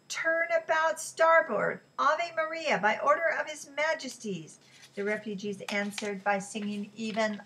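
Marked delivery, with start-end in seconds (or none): 0.1-4.6 high, raised official hail; loud, sustained naval command; bullhorn order. A narrator's reading. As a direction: call it across the water like a bullhorn. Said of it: "the bullhorn ordered".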